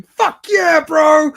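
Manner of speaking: high, loud triumphant yell; excited shouting. Retold or imitated. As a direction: re-enact a fist-pumping cheer at full voice. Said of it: "Yeah, I'm shouting".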